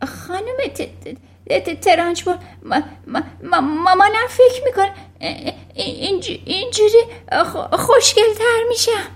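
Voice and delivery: put-on very high, frightened child's stutter; trembling stammer. A narrator's reading. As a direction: stammer helplessly on each word start.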